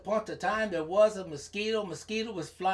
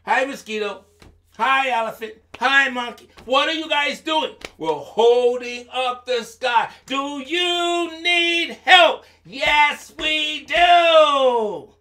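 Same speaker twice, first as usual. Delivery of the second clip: loud, higher storybook dialogue building to a shout; calling animals; 6 semitones higher, 8 dB louder, slower than usual. Acted. Newly arrived animals offering help, calling out louder. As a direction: build from greetings to a long holler. Animals greet and answer each other.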